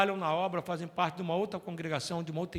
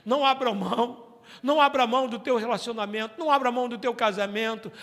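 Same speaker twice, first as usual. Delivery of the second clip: stressed, raised urging; preaching; 4 semitones higher, 6 dB louder than usual. Acted. Pastor urging the church, preaching. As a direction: press the plea on the congregation.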